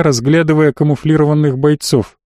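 ordinary delivery

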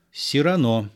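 plain reading voice

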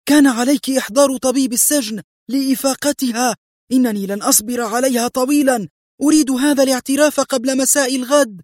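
high, furious dressing-down; acted high-pitched angry tirade; angry scream. A narrator's reading. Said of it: "صرخت مرتانا في غضب".

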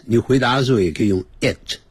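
ordinary delivery

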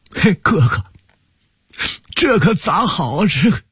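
high wailing lament, trailing off; too grief-stricken to go on; breaking down. Acted. Younger brother cries out to his elder brother, breaking down in grief. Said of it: "实在说不下去了".

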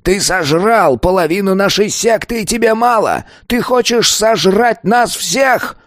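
rising to high, strained fury; enraged accusation. A narrator's reading. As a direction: spit accusations, climbing high after the insult. Enraged elder accuses the pupil. (яростно, срываясь на высокий крик)

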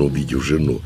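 plain reading voice

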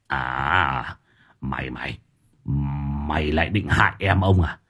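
low, soft menace through gritted teeth; teeth-gritting threat. Acted. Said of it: "Lão nghiến răng ken két". (nghiến răng đe dọa, giọng trầm)

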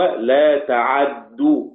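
"لا تعدو" slowly, full fatha on the ayn, doubled dal; Warsh's reading. Shown as a demonstration. Teacher showing students Warsh's recitation, slow "لا تعدو" with voweled ayn and doubled dal. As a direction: draw out "لا تعدو" with open ayn and heavy dal. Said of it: "بفتح العين وتشديد الدال".

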